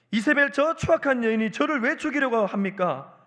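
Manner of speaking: acted-out complaint of a wronged prophet; aggrieved Elijah. Elijah pleads his loyal service to God, aggrieved protest. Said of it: "제 버전으로 제가 각색했습니다".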